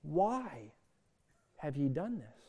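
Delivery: grieving angel pleading; pleading scripture reading. Acted. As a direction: read the angel's plea with grief. Angel's words read, pleading.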